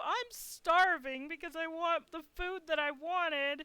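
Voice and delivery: high, slow wail; plaintive upset. Retold or imitated. Guest telling front desk lady she's starving, high plaintive voice. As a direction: whimper it out like a hungry, upset child.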